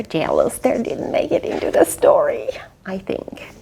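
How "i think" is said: unvoiced whisper aside. Real, not acted. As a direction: breathe it as a secret. Aside to listener.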